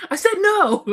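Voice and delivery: loud, high imitated yell; mock freak-out. Co-host mimics the guide freaking out, mock yell. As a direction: shout it in hammed-up outrage. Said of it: "Freaks out".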